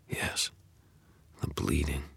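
hushed dread; whispering. A narrator's reading. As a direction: breathe it out. Narrator echoes dread in whisper.

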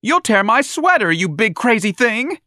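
very high, loud fluster; alarmed protest. Acted. Young man defending his sweater, high panic. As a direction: squeal in panic.

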